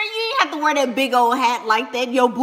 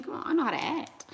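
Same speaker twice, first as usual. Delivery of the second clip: usual speaking voice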